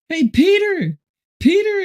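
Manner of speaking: high-pitched excited shout of a name; imitating an excited wife calling out. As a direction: call out in a high, thrilled voice. Voicing John's wife calling out.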